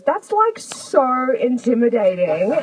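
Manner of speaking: high, slow mock Australian accent; imitated Australian accent. Pop star confessing nerves to the crowd, imitated Australian accent. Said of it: "And she does that accent".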